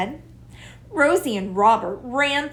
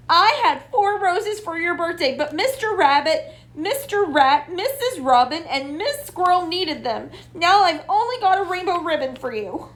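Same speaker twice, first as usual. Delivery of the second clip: higher, fast tumbling words; rushed; 8 semitones higher, 3 dB louder, faster than usual. A narrator's reading. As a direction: blurt it all out. Rosie rushes her confession.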